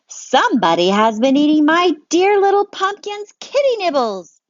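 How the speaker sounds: a mom protesting her kitty's food; loud high indignant mom; indignant mommy. Acted. Mommy voiced in read-aloud story.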